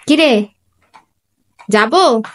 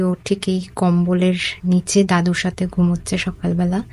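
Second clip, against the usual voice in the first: ordinary talk, nothing marked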